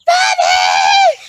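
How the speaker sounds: piercing, very high full-volume shriek; long, shrieked name call; imitated mom's scream. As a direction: scream the name like an outraged mother. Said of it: "I hear his mom go".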